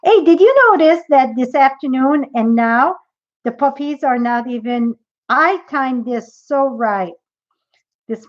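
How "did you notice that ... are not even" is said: ordinary talk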